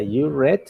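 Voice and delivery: "read" said like "red" after "you"; short e in "read", rhyming with "bed"; past-tense pronunciation. Shown as a demonstration. Teacher repeating the past example, "read" pronounced "red". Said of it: "Y se pronuncia read".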